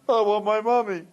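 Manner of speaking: put-on high, whiny child voice; crying little boy. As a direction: imitate a small boy wailing. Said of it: "The little boy starts crying".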